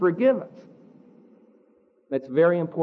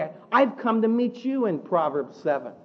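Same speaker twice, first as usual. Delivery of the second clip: normal talking voice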